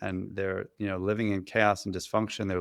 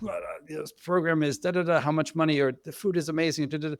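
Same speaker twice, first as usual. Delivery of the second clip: complaining family; 7 semitones higher than usual. Retold or imitated. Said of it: "The families would immediately be like".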